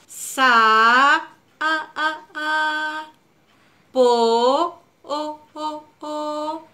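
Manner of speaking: "Sa, a, a, a, po, o, o, o" with every vowel held long; stretched vowels. Shown as a demonstration. Teacher to child, holding the vowels of sapo. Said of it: "Ahora veamos sapo".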